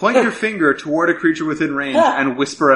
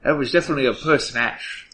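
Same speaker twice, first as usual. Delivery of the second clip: inspector in character; faster than usual. Acted. Game master answers as inspector.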